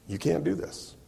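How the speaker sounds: ordinary talk, nothing marked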